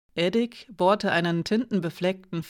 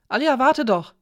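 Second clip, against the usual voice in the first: higher, louder urgent call; calling after her; 7 semitones higher, 5 dB louder than usual. A narrator's reading. Edik hurries after Alina as she leaves, calling out. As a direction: call out to make her wait.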